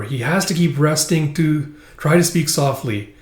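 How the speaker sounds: ordinary talk, nothing marked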